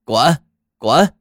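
loud hoarse barking shout; a chained watchdog barking; barking old dog. Acted. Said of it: "看家狗叫道".

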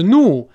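"new" as "noo", y dropped completely; wrong, no glide. Shown as a demonstration. Teacher shows second wrong version, "new" as "noo". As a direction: drop the y entirely and say "new" as "noo". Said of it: "也不是new".

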